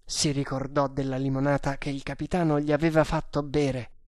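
ordinary delivery, nothing marked

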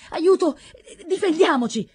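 high, loud alarmed cry; panicked crowd-style yelling, pitched high; cried for help. A narrator's reading. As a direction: shout out for help as villagers under attack. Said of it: "gridarono quelli".

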